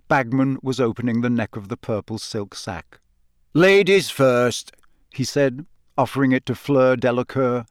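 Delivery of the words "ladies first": slow, drawn-out announcer's invitation; higher, stretched-out gallant offer; jovial host. A narrator's reading. Bagman offers Fleur the bag, jovial and drawn out.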